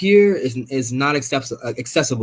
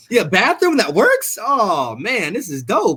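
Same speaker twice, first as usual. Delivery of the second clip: laughing delight; 7 semitones higher, slower than usual. Retold or imitated. Retelling his delight as a kid.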